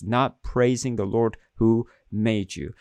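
ordinary talk

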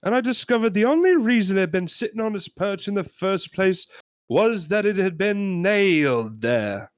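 lightly voiced aggrieved customer; John Cleese customer. Customer complaining to pet shop owner about a parrot, lightly voiced Cleese. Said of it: "I made myself John Cleese in that sketch".

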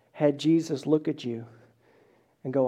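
normal talking voice

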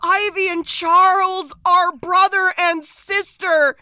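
mock dismay. Host announces the siblings reveal, in mock horror. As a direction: wail the scandal with relish.

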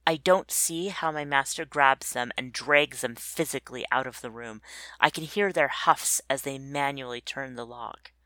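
ordinary delivery

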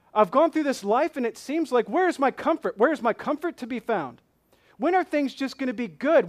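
loud, high, pleading cry to God; voicing the people's complaint. Preacher voicing weary believers crying out to God, loud and pleading. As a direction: cry out like someone worn down, demanding an answer. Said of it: "The complaints of the people of Israel".

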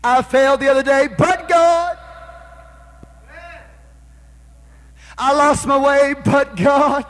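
high, loud, rising sermon cadence; preaching at full force. Acted. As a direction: preach it hard, each "but God" a hammer blow. Preacher at climax, crowd call.

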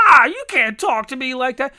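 high, loud whiny protest; mock the spoiled kid talking back; whiny employee. Imitating a whiny new hire protesting to his boss.

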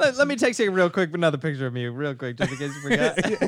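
real laughter running through the words; laughing joke. Real, not acted. Host teasing the director about his looks, laughing.

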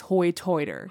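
"Hoi Toiter" with oy vowels for long i; island dialect pronunciation. Shown as a demonstration. Naming the island dialect, "Hoi Toiter" said with oy vowels. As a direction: say "Hoi Toiter" with rounded oy in both words. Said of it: "But it's Hoi Toiter".

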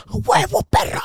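high, hammed-up goal celebration cry; mock victory yell. Boy celebrating his goal at the older kid, mock yell. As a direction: crow over the goal like a cocky kid.

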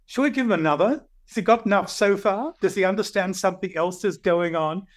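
mischievous gods nudging each other; playful unseen powers. Retold or imitated. Hidden powers tease each other about him, playfully. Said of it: "nudging each other and chuckling".

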